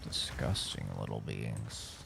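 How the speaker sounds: a hint of a sneering monster, low and soft; low, quiet mock creature voice. Echoing the enemy, low and quiet. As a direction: growl it low like a disgusted fiend.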